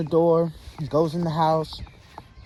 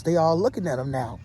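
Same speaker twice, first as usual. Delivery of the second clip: ordinary delivery, nothing marked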